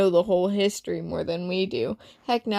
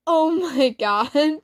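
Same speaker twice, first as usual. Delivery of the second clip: laughing; 7 semitones higher, 4 dB louder, slower than usual. Acted. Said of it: "I burst into laughter".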